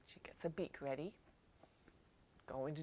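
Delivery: softly whispered aside; hushed storyteller whisper. A narrator's reading. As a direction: whisper it to the children.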